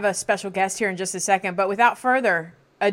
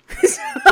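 quick, high, loud laugh; brief real burst of laughter; short laugh; 6 dB louder than usual. Real, not acted. Laughs at own remark.

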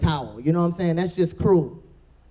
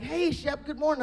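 high, soft sweet voice; a hint of a chirpy coworker; sweet greeting; 9 semitones higher, 6 dB quieter than usual. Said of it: "She was like".

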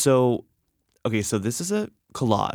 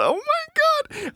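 much higher, slow, embarrassed squeal; overwhelmed squeal; much slower than usual. Real, not acted. Reading his childhood note aloud, squealing in embarrassment. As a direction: squeal it out, mortified.

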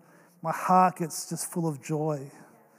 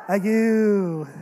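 mock baby goo, louder; imitating baby coo; 3 semitones higher, 8 dB louder than usual. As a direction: copy the baby's coo. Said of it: "him going".

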